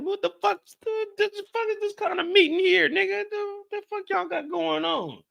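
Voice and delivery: high, hammed-up indignant yelling; mock outraged. Imagining himself walking into the meeting, high mock outrage. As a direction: ham up the shock at this weird meeting.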